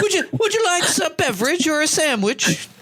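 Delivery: put-on high, posh-ish English accent, offering drinks; mock English golf cart lady. Host mimics golf cart lady offering drinks, mock English accent. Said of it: "I don't know why she had an English accent either".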